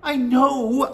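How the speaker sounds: put-on high, exasperated boy; crying out. A narrator's reading. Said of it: "I cried".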